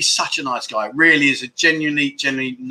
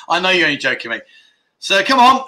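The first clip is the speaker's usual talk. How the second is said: normal talking voice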